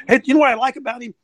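ordinary talk, nothing marked

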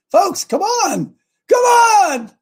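high, drawn-out exasperated plea; incredulous urging. Real, not acted. Host urging listeners to see the obvious, incredulous. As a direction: stretch it out high, pleading with them to see it.

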